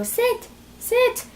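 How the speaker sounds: squeaky, unsure "Sit, sit." the dog ignores; wrong way to command a dog: high and timid; weak pleading command. Shown as a demonstration. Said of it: "no, don't go".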